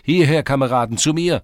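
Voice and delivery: high, very loud hail; rallying shout; shouting to comrades. A narrator's reading. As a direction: call them over at full voice. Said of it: "schrie er und winkte den Burschen zu".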